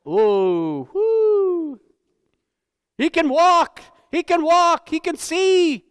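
high, loud hammed-up healer shouting miracles; mock faith healer. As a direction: ham it up like a TV faith healer whipping up the crowd. Mocking faith healer stage show.

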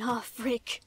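ordinary delivery

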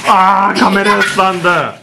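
laughing shout. Real, not acted. Dad to kids who splashed the camera, laughing shout.

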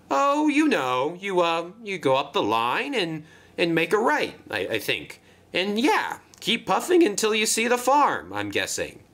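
high-pitched, hesitant directions; unsure Marion. Acted.

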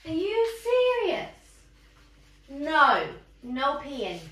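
high-pitched, disbelieving telling-off; scolding the dog. Real, not acted.

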